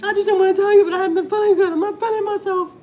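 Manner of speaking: high, loud crying whine; imitating her own weepy outburst; tearful confession. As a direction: wail it out like a crybaby. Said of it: "crying like that".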